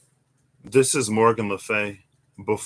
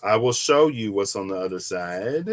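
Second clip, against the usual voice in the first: usual speaking voice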